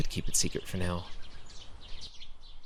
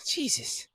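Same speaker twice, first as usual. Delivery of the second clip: relieved sigh. Acted. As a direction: let the fear out in one sigh.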